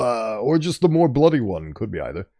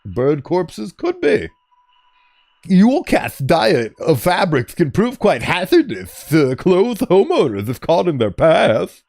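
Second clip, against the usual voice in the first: higher lisping know-it-all; nerd voice; 6 semitones higher than usual. Pretend. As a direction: read it like a nasal nerd. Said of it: "the lisp was a very big nerd indicator".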